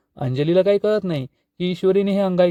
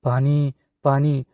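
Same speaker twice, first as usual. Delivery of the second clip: slow, faint begging for water; weak plea for water; slower than usual. Acted. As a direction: croak it out like a dying patient. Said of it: "तर राजेश म्हणतो". (क्षीण आवाजात हळू पाणी मागणे)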